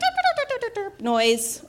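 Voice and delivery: high, squeaky imitated bird honks 'derp derp'; imitated toy bird noise. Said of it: "out comes that derp".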